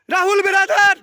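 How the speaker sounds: mocking loud call. Real, not acted. Calling out opponent mockingly.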